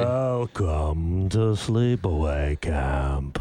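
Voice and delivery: very deep, slow trailer announcer; movie trailer voice. Acted. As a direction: draw it out like an eighties horror trailer. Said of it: "nineteen eighties trailer voice guy".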